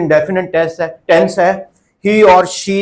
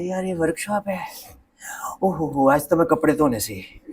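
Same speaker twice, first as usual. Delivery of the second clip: mock-grumbling teacher, half-whispered; imitating reluctant teachers; 2 semitones lower, 10 dB quieter, faster than usual. Trainer mocks teachers who slip away from the workshop, whispered grumble. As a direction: mutter it under your breath like a teacher dodging a workshop. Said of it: "और वो बोलते हैं".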